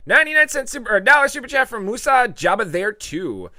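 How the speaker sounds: high, loud thank-you read; loud high announcement; excited shout-out. Real, not acted. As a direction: hype the donation. Excited super chat read.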